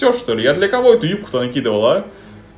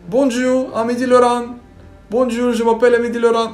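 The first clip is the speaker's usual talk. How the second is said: higher, mock French accent; 10 semitones higher, 4 dB louder than usual. Shown as a demonstration. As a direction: play it up like a Parisian. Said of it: "сейчас с французским акцентом".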